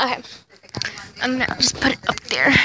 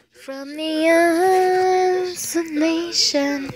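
drawn-out, slightly higher eerie line; lightly hammed-up ghost voice; slow spooky voice again; 4 semitones higher, 3 dB quieter, much slower than usual. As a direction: stretch every word slowly.

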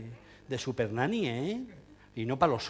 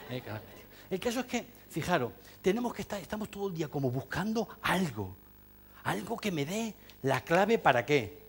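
ordinary talk

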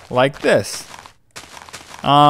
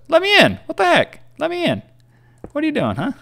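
much higher, cartoonish pleading voice; squeaky little creature. A tiny game creature begging to be let inside, in a squeaky cartoon voice.

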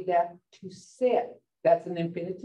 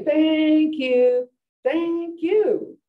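loud, high, drawn-out thanks; with feeling; 8 semitones higher, 6 dB louder, much slower than usual. Shown as a demonstration. Teacher showing how English speakers say thanks, full of feeling. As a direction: stretch it out gushingly, pitch high. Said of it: "You say the same way".

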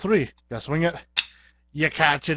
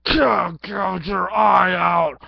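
gleeful menace; 6 semitones higher, 8 dB louder, much slower than usual. Pretend. Gleefully describing the attack.

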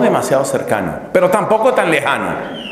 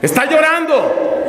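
much higher, drawn-out mock astonishment; startled realization; 13 semitones higher, slower than usual. Confessor suddenly noticing the woman is crying, acted startled alarm. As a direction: blurt it high, stretching the words in alarm. Confessor acts discovering she cries.

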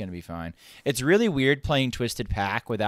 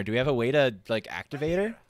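ordinary talk

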